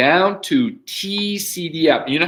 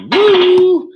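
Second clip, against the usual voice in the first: loud, high cheer; excited whoop; 9 dB louder than usual. Real, not acted. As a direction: whoop with delight. Cheering about lunch arriving.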